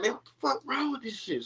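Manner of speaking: high, irritated grumble at a lighter; a half-laughing angry mutter about a broken torch; mock angry gripe. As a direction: fuss at the torch that won't light.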